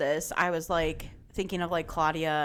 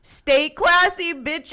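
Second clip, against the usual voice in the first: slow, drawn-out high loud Stay classy; mock-sassy sendoff, stretched out; sassy sign-off; 9 semitones higher, 7 dB louder, much slower than usual. Podcast host signing off at full sass. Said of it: "And I said".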